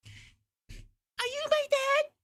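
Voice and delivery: put-on high, slow little kid voice; child's voice. Little daughter asking the wrestler, high child's voice. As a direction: ask it like a small shy child.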